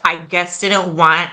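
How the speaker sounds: ordinary delivery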